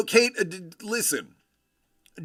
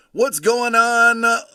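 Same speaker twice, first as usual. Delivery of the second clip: excited greeting; 8 semitones higher, 9 dB louder, slower than usual. Real, not acted.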